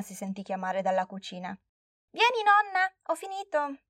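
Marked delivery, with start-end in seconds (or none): 2.1-3.8 put-on high, calling girl voice; excited child calling. Girl calls grandma to the kitchen, high excited child. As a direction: call grandma in, proud and high.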